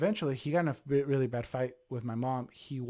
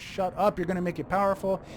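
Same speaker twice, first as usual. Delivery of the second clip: louder, higher, quickening retold snap; annoyed outburst; 6 semitones higher, 5 dB louder than usual. Husband warning his wife to stop talking, annoyed. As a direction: cut her off with raised voice, imitating an annoyed dad. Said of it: "he gets annoyed".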